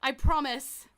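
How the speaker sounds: loud, resigned promise; shouted promise. Acted. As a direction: yell the promise, giving in. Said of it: "and goes".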